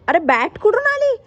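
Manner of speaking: high, loud amazed outburst; a delighted high cry; surprised. Real, not acted. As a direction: react like you can't believe it. Kids suddenly playing with bat.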